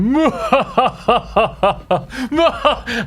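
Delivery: sinister laugh on request; villainous evil laugh; evil chuckle. Pretend. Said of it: "stroke your beard and make a sinister laugh".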